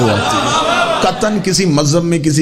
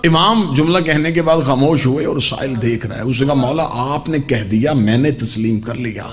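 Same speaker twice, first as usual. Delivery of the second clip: low, measured retelling of a holy dialogue; hushed sermon storytelling; 5 semitones lower than usual. Acted. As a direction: draw the listeners in with a low voice. (دھیمی آواز میں قصہ گوئی)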